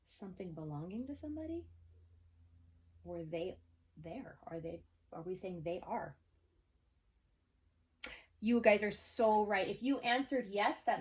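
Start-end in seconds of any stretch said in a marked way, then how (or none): none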